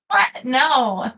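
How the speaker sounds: high, loud disbelieving what; incredulous what. Real, not acted. To chat teasing her, a high incredulous what. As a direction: shoot up in disbelief.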